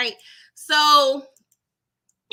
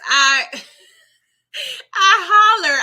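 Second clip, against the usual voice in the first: loud laugh; 8 semitones higher, 9 dB louder, slower than usual. Real, not acted. Laughing loudly.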